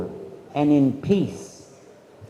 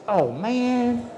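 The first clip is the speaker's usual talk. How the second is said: put-on higher, softer whine of a bored kid; whiny complaint; 6 semitones higher, 3 dB quieter than usual. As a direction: complain like a kid told to do chores. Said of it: "You're like".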